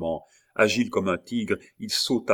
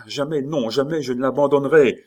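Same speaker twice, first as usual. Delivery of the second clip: high, loud, rushed protest; urgent raised cry; frantic refusal; 7 semitones higher, 7 dB louder than usual. A narrator's reading. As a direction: refuse desperately, rising in volume. Frantic refusal to leave Bussy.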